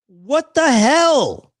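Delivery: slow, loud, high what the hell; outraged cry. Real, not acted. Seeing the castle lit Dodger blue, stretched outraged yell. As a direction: blurt it out in outraged disbelief.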